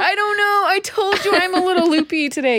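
high, loopy, giggle-tinged voice; giggly. Real, not acted. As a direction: talk just after a real giggle fit. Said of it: "you're really having a giggle now".

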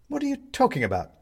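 high, fast, baffled; a confused man, high and quick; bewildered. Acted. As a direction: rush the question in confusion. Benedict bewildered.

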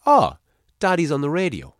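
put-on high, loud little-child voice; excited small boy spotting Daddy on the radio; Fionn. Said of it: "And what does Fionn say?".

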